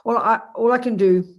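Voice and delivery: usual speaking voice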